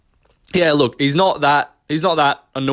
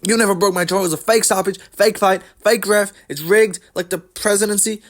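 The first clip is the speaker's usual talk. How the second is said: high, put-on raging-kid rant; Colby's mock tantrum; 7 semitones higher than usual. Mocking a fighter disputing his stoppage, high tantrum voice. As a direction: whine it high like a kid's tantrum. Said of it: "like a little fucking raging kid".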